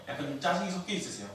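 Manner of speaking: ordinary talk, nothing marked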